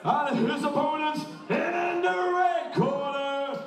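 grand entrance announcement. Acted. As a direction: stretch the names out like fight night. Ring announcer introduces fighters.

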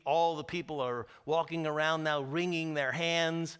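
usual speaking voice

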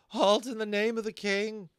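high, loud guard's challenge; young corporal challenging the band; called command. A narrator's reading. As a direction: call the halt across the yard. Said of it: "he called out".

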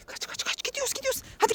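urgent hushed whisper; whispering while hiding. Pretend. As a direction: whisper as you sneak off. Sneaking away from the witch.

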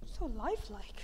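hushed whisper. Pretend. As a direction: breathe it in a whisper. Whispered at museum exhibit.

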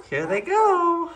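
high, excited puppy cheer; excited squeal. Real, not acted. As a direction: cheer the stumbling puppies on, high. Excited at puppies walking.

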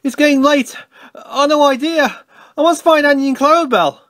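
high, anxious excuse-making; flustered alarm. A narrator's reading. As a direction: panicky storybook voice, pitched high. Thomas makes excuse to flee.

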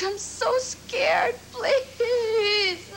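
slow, tearful begging breaking into sobs; sobbing plea. Acted. To George, begging him not to leave, sobbing. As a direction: let the tears break through the words.

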